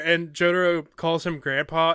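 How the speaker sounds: ordinary talk, nothing marked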